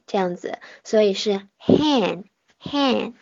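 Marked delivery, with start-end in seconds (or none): 1.6-3.1 very slow hen, lips spread, ending on a nasal n; careful slow hen; hen demo. Shown as a demonstration. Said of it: "咧嘴不张嘴".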